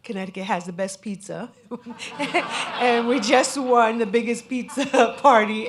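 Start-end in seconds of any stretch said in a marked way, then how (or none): 1.5-2.7 a loud, high burst of laughter. Real, not acted. Speaker bragging about Connecticut pizza, bursting out laughing. 2.8-5.7 much louder and higher, laughing through the words. Real, not acted. Proud Connecticut local boasting about the pizza record, laughing loudly.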